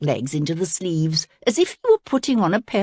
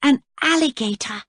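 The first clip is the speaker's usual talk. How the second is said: surprised; 8 semitones higher than usual. A narrator's reading. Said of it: "cried Princess Cora".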